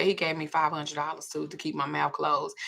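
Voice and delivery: ordinary delivery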